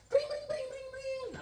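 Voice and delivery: very high, soft rapid ringing; high-pitched "bing" ringtone noise; imitated phone ring. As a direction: mimic a ringing phone in falsetto.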